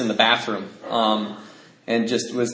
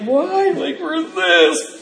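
put-on high, bewildered child; boy puzzled by the apology; high kid voices; 14 semitones higher, much slower than usual. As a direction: pipe it up like a confused boy. Kids' exchange voiced.

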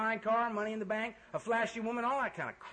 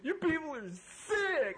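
high, slow, half-laughing mock sob of the friend; imitating crying friend; 8 semitones higher, slower than usual. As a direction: play the shocked friend, pitched up, laughing through it. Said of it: "She's crying".

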